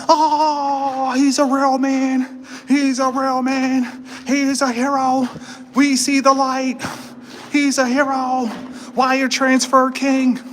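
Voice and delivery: mock reverent praise. Praising wire transfer man.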